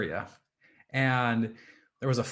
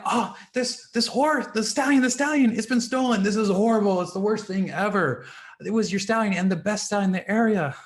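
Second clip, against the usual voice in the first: high, loud, panicky outburst; alarmed villagers; 9 semitones higher, 7 dB louder than usual. Acted. Villagers tell the farmer his stallion is stolen, loud and panicky. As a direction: wail the bad news much higher and louder.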